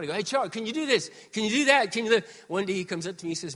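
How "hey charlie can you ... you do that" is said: calling down loud to the sound guy; yelling down. Retold or imitated. Pastor calling down to the sound guy, loud. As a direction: shout it down from the balcony. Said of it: "I would yell down".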